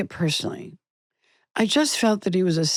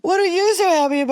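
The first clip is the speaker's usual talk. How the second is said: high, fast mock question; high put-on voice, quick and teasing; puzzled coworker; 11 semitones higher, faster than usual. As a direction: squeak it like an amused colleague.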